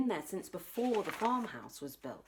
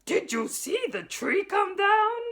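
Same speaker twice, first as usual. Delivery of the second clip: much higher, louder gleeful whoop; 12 semitones higher, 9 dB louder than usual. Acted. Sputnik gloating about the tree, whooping. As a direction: whoop with delight. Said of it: "he whooped".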